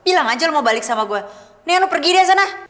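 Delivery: angry dismissal. Acted. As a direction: order him out in a temper. Girl sends boyfriend away.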